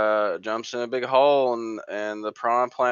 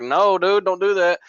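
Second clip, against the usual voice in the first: higher, louder urgent warning as Cortana; mock Cortana warning; 8 semitones higher, 5 dB louder than usual. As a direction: blurt it like an AI stopping you. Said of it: "Cortana's like".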